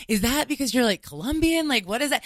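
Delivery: imitating her past self. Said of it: "was like".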